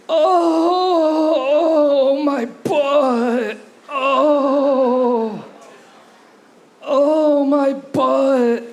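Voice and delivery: put-on high, pained old man groaning about his butt; hundred-and-five-year-old man. Comic groans at the club crowd as a very old man. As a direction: moan like an ancient man with a sore backside. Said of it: "an impression of a hundred and five-year-old man whose butt hurts".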